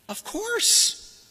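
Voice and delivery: high, sarcastic of course. Acted. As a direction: say it with a smirk. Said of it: "But he doesn't mean "of course".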